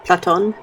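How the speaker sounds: "Platon" as plah-TON; trying a pronunciation. Shown as a demonstration. Unsure of a character's name, trying "Platon" as plah-TON. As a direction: say "Platon" with a full "on" ending, questioning. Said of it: "How do you pronounce that?".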